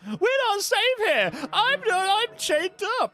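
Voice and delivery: high, fake-innocent damsel voice; mock princess voice. Mimics the princess's plea.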